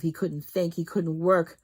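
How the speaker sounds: ordinary delivery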